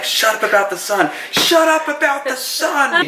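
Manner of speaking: hammed-up exasperated outburst; Gabe snapping. Husband mimicking Gabe yelling at Andy. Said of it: "Gabe just loses it".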